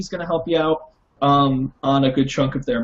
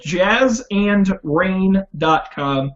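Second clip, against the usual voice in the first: ordinary talk, nothing marked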